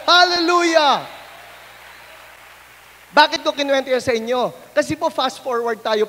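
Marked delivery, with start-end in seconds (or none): 0.1-1.0 loud, high praise; exultant hallelujah; joyful shout. Real, not acted. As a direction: shout it out to the congregation. Preacher celebrates.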